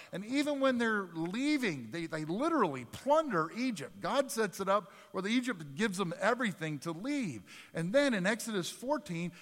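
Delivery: fervent sermon build; preaching at full force. Acted. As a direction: rise with each miracle like a revival preacher. Building recital of miracles.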